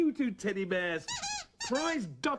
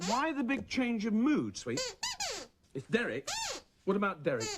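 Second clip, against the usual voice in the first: ordinary delivery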